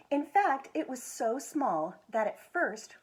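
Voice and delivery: gentle, expressive picture book reading; reading to children. Acted. School foundation director reading a picture book to children, expressive storytelling. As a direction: tell it like bedtime story time.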